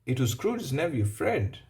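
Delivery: ordinary delivery, nothing marked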